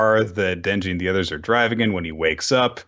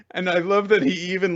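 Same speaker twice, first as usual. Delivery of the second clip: ordinary delivery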